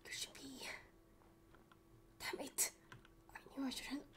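hushed, breathless whisper. Acted. Searching woods for missing Belle, frantic whisper. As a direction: whisper so no one hears.

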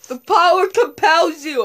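loud dramatic exorcist chant; mock exorcist. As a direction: cast it out with grand authority.

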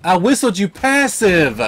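loud, high outraged yell; exasperated shout. Real, not acted. Gamer yelling at disobedient pet, outraged. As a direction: bellow in despair as it falls. Pet ignores command, dies.

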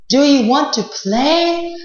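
princess voice. Acted. Princess voiced in told story.

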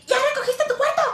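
high, loud nagging shriek; shrill nagging yell. Retold or imitated. Mom nagging son about his room, high loud yell. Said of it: "en vez de llegar y".

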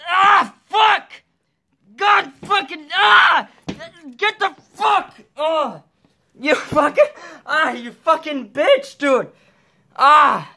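high pained swearing shouts; swearing tirade. Real, not acted. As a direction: yell curses through the sting. Stung by scorpion, yelling.

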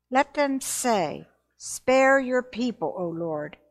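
normal talking voice

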